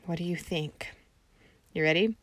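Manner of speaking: ordinary delivery, nothing marked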